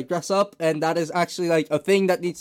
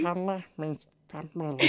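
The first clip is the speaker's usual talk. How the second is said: Stitch impression, soft and rasped; 11 dB quieter than usual. Shown as a demonstration. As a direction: lightly croak it like Stitch. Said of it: "Lilo and Stitch".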